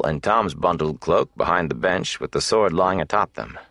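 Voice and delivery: ordinary delivery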